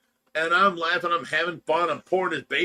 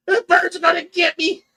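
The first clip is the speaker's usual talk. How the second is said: much higher, loud mock child's scream; retold panicked scream; 9 dB louder than usual. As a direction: shriek it like a terrified kid chased by a bird. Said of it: "I'm screaming and crying".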